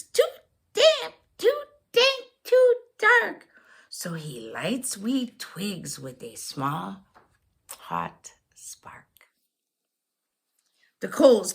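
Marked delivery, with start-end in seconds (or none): 0.0-3.5 tiny squeaky high voice; high mouse voice. Acted. 3.9-9.3 whispering. Acted. Hushed storytelling by sleeping bear.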